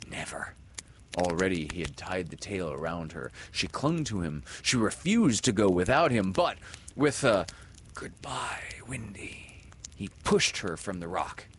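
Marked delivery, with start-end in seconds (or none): 0.1-0.5 whispered refusal. A narrator's reading. Peter refusing gallantly. 7.9-9.6 whispered goodbye. A narrator's reading. Peter's farewell on rock.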